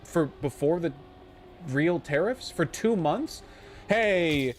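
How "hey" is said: mock Trump official. Mocking Trump's team's stance.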